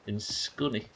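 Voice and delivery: ordinary talk, nothing marked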